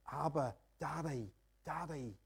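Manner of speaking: intimate cry. Shown as a demonstration. Preacher showing the Spirit's cry to the Father, soft and slow. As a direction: call to God like a child to a father. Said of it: "can you speak like that".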